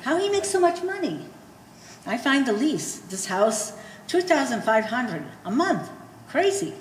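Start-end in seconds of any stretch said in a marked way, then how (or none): 0.0-1.3 much higher, baffled; baffled question. Acted. Mother baffled about son's money. 6.3-6.7 amazed. Acted. Mother exclaiming over rent.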